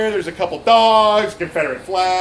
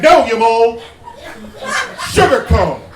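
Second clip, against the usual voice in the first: loud, higher, barked reprimand; scolding coworker; 5 semitones higher, 7 dB louder than usual. Retold or imitated. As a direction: snap at the trainee like a bossy coworker. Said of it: "slap it out of my hand and be like".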